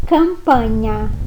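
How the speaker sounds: campagna slow, with gn as nh; slow Italian word. Shown as a demonstration. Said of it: "em italiano".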